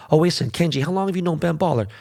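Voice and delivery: lightly voiced nosy fan asking questions; mock curious fan. People asking Kenji about Ben, a lightly mocking fan voice.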